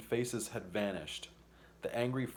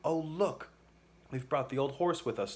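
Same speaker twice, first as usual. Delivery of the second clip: put-on higher, excited exclamation from a girl; cried out; 5 semitones higher than usual. A narrator's reading. Polly noticing the horse came along, excited cry. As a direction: burst out with surprised delight. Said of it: "Polly cried out".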